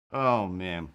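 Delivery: normal talking voice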